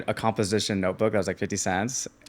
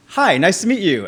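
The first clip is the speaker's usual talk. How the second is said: higher, polite normal-guy greeting; polite friend; 9 semitones higher, 4 dB louder than usual. Retold or imitated. Friend meeting new people, overly polite. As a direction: play it perfectly sane. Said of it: "he's like".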